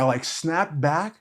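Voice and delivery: usual speaking voice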